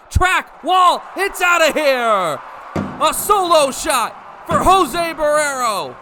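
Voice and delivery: high, loud shouted call; home run call. Acted. Announcer calling a solo home run. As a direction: rise to a shout at the wall.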